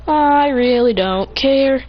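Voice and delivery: loud, very slow, drawn-out dismissal; acted indifference. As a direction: stretch each word to show you don't care. Character walks away indifferent.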